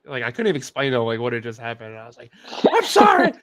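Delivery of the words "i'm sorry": much higher, much louder mock yell of "I'm sorry". Son yelling "I'm sorry" to his dad, frantic high shout. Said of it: "I'm just, like, yelling".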